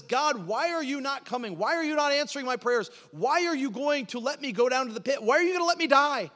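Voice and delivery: high, loud cry to God; anguished prayer shouted upward; desperate pleading. Acted. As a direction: plead urgently, pitched far up, question after question. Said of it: "he is pleading with God".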